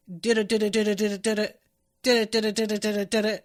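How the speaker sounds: rapid, even "dida-dida" ringtone chant; imitated ringing sound. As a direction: imitate a phone ring with your voice. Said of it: "It's a person going like".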